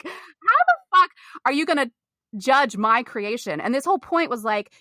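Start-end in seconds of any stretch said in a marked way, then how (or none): 0.0-3.6 high, outraged mock confrontation; indignant outburst. Student retells defying her teacher who mocked her dream, high and outraged. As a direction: fling the question back at him, indignant.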